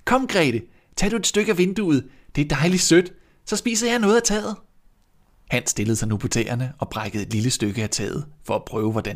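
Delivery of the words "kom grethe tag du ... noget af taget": put-on high, eager boy's voice, fast at the end; a delighted child urging his sister on; glad dreng. A narrator's reading. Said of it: "råbte Hans glad". (ivrig, lys barnestemme)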